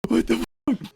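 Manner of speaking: high-pitched mock bewilderment; alarmed bystanders. Shoppers fearing someone is being murdered, imitated high alarm. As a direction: repeat it high, like a startled onlooker. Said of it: "they think someone's getting".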